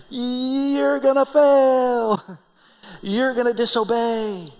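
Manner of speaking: high, drawn-out proclamation of doom; Moses. Acted. Preacher as Moses warns Israel of failure, proclaiming doom. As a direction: stretch each verdict out long and high. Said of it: "that's really terrible inspirational speaking".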